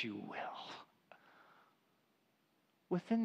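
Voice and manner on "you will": slow, soft whispered assurance; quiet comforting whisper; tender whisper. Retold or imitated. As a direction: whisper it gently, slowly. Pastor's tender reply.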